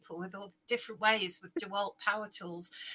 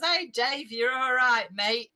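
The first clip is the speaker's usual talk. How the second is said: a hint of a loud, matey bloke; hearty mate greeting; 6 dB louder, slower than usual. Husband slaps Dave's back, a touch loud and matey. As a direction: boom out a friendly back-slapping hello.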